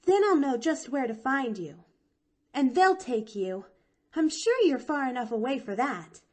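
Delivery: put-on high, eager little girl; young girl voice. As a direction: chirp it out like a bright chatty child. Pollyanna chatters excitedly.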